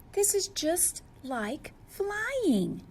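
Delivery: awed. Acted. Awed penguin underwater.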